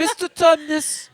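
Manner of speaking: high, slow British schoolboy; hammed-up British child actor; Edmund. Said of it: "I played Edmund in The".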